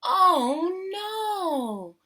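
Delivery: ordinary delivery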